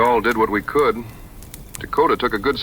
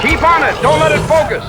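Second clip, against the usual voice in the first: high, loud urgent orders; loud high commands; shout; 7 dB louder than usual. Acted. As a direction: bark orders over the chaos. Directing the fight.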